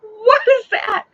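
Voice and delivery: loud, high, drawn-out shriek of a question; retold panic. Driver sees something moving in the dark, retold panic. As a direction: shriek it slowly in horror.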